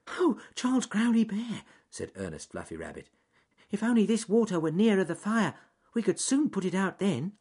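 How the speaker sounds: high, wistful little rabbit. A narrator's reading. Ernest talking to the bear by the lake, high wistful rabbit voice. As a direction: pitch it up, wishing aloud.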